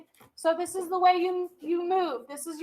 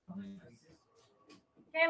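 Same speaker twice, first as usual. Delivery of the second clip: a low, quiet, couldn't-care-less okay; careless okay; 10 semitones lower, 9 dB quieter than usual. Retold or imitated. Teacher mimicking a student who strolls in late, uncaring. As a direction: shrug it off as if being late is nothing. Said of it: "like, you don't care that you're late".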